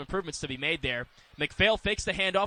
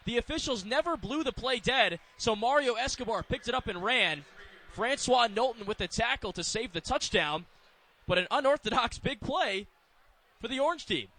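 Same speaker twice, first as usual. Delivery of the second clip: higher-pitched, urgent football call; excited play-by-play; 6 semitones higher than usual. Real, not acted. Announcer follows a loose ball run back, excited play-by-play.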